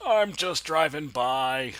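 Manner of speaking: sing-out reply, stretched and loud; mock protesting call. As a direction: call back playfully as a passer-by. Playful reply to mock shout.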